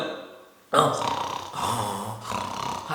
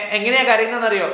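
ordinary delivery

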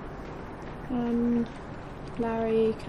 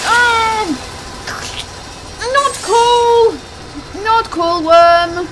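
loud annoyed outburst; indignant yelling; 7 semitones higher, 8 dB louder, much slower than usual. Real, not acted. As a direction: yell at the worm. Yelling at the game worm.

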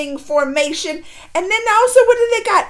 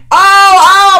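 loud, higher exclamation; real outraged outcry; 6 semitones higher, 16 dB louder than usual. Real, not acted. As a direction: cry out in pained outrage. Upset recalling beating of boy.

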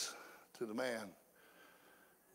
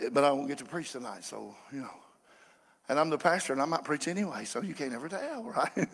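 normal talking voice

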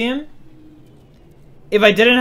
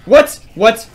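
startled; 3 semitones higher, 6 dB louder than usual. Real, not acted. Ambushed by pirates.

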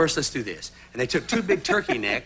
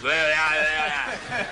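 repeated bear bellows; imitated bear roar; much slower than usual. Guest ending his escape story, imitated bear roar. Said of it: "and the bear was".

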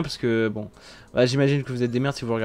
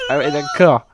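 laughing; 4 dB louder than usual. Real, not acted. Still laughing after a big laugh.

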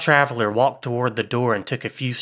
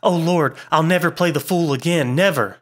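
understated hysteria, high-pitched lament; hysterical; 6 semitones higher than usual. A narrator's reading. Frightened man swearing off playing the fool, hysterical. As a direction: wail it a little, without real sobbing. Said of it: "with a hysterical sob".

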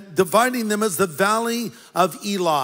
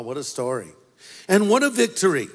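ordinary delivery

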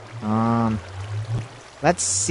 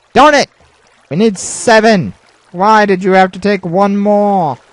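loud, high-pitched complaint; frustrated outburst; 7 semitones higher, 10 dB louder than usual. Real, not acted. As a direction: protest loudly at being one short. Short of diamonds, complains loudly.

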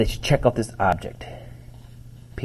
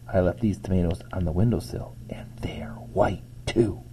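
softer, hushed whisper; 3 semitones lower, 9 dB quieter than usual. A narrator's reading. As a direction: lean in and whisper the worry. Mrs Monroe confides worriedly.

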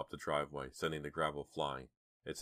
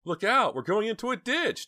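high, loud panicked scream; frantic high-pitched yell; screamed warning; 10 dB louder than usual. A narrator's reading. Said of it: "Dina screamed".

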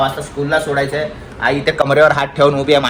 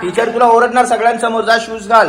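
higher, louder telling-off; scolding; 6 semitones higher, 4 dB louder than usual. Real, not acted. Father scolding his son about shoes, raised voice. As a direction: warn him the teacher will shout.

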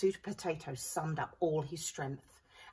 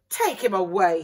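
louder firm order; commanding hero; 10 dB louder than usual. A narrator's reading. Sue Potato ordering the pea away. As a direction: order it with authority. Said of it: "he said".

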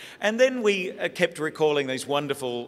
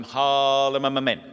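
"Harlem Amen" stretched long and steady; slow drawn-out amen; 5 dB louder, slower than usual. Retold or imitated. Children matching the aunt's pace, drawn-out amen. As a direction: drag out "Harlem Amen" like the aunt. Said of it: "stay with Auntie Claire".

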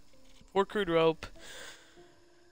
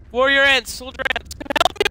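high, loud call to the ants; calling for help; 10 semitones higher, 6 dB louder than usual. Real, not acted. Gamer calls soldier ants for help, loud high cry.

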